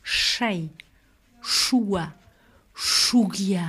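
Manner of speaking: "Chai, choua, chougia" opening on a Basque sh-like s; hushed s, near Spanish s, in "Chai, choua, chougia"; hushing S. Shown as a demonstration. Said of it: "Le S est chuente". (« Chai, choua, chougia » : s chuinté)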